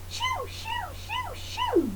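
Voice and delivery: very high, soft shoo shoo. Shown as a demonstration. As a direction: shoo it away in a tiny high voice. Shooing the lion away.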